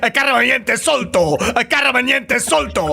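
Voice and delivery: high, excited fake-Spanish riffing; mock Spanish accent. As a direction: parody a comic's over-the-top Spanish accent. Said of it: "repeating what she said in an accent".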